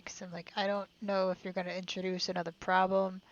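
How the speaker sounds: normal talking voice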